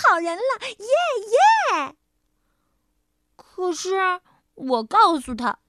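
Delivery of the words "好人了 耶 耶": cheering. Acted. Mouse cheers at last.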